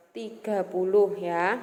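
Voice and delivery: ordinary talk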